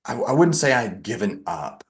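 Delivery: ordinary talk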